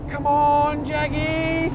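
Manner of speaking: high, eager urging. Real, not acted. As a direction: coax the car forward. Urging his car during the race.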